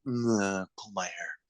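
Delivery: soft, breathy-free sultry murmur; mock sultry whisper. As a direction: say it low and seductive. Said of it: "whisper something weird at the end".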